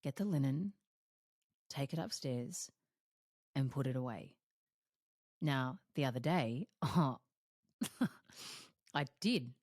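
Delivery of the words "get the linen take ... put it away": low, soft, calm instruction to herself; calm self-instruction. Retold or imitated. Coach instructing herself to put the linen away, calm and low. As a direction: tell yourself gently but firmly. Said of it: "I'll say to myself".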